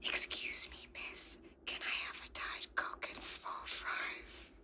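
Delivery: breathy whispered repeat of the order. Retold or imitated. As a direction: whisper it like you're in a library. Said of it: "so the blonde goes".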